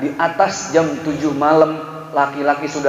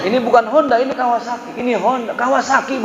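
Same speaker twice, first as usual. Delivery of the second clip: heated argument; 8 semitones higher than usual. Retold or imitated. Argument over motorbike brand.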